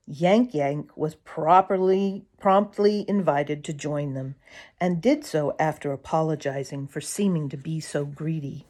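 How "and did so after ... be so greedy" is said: ordinary delivery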